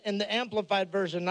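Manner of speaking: ordinary delivery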